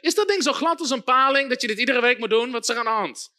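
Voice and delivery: usual speaking voice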